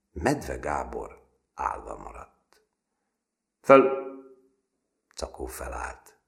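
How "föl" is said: high, loud snap; loud drill command; barked order. A narrator's reading. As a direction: bark it at the boy. Sergeant commands recruit.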